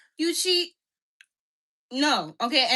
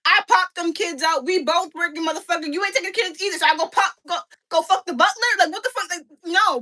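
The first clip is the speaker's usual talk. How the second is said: raised, higher indignant rant; heated outrage; 6 semitones higher, 3 dB louder than usual. Real, not acted. Ranting at husbands who cheat with the help, higher and louder. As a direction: let it rip, fed up and furious.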